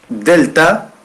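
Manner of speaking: "Delta" said high as a teaching demo; letter name said aloud. Shown as a demonstration. Teacher to online class, naming the letter Delta aloud. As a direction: pronounce "Delta" clearly for the class. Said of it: "delta au zelta".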